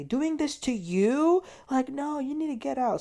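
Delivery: high-pitched retort, imitating a scoffing group; retold group disbelief; mock incredulous. Group scoffs back at Gretchen.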